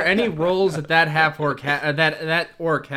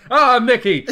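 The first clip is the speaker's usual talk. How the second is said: loud, higher in-character cry; mock exclamation; 8 semitones higher, 9 dB louder than usual. Player grabbing a taller man by the shoulder, loud exclamation. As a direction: call out the name in a burst. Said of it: "just grab him by the shoulder and just like".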